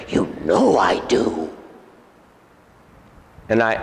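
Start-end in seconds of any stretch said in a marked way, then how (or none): 0.1-1.5 low, slow demon insisting. Retold or imitated. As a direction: repeat it deep and drawn out, as the demon. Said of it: "That's what he said to me".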